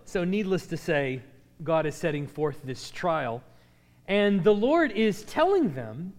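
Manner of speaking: ordinary talk, nothing marked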